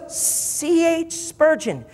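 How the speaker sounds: drawn-out, high-pitched name; slow, emphatic repeat. Retold or imitated. Agent repeating the name to the owner, slow emphatic repeat. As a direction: spell it out very slowly, acting the agent.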